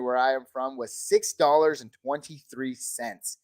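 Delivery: normal talking voice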